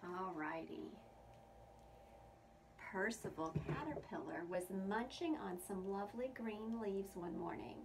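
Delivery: plain reading voice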